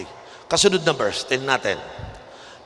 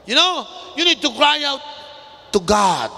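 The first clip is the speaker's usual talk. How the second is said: raised, driving pulpit voice, slowing on key words; fiery preaching; 4 semitones higher than usual. Acted. Pastor urging the congregation to shout to God, fiery preaching. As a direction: preach it hard, calling them to cry out.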